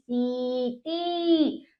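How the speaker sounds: सीटी very slow, both ई held long; drawn-out vowels. Shown as a demonstration. Teacher models long vowels for children, drawn out. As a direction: softly stretch each syllable of सीटी. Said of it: "हम इसको खींचेंगे".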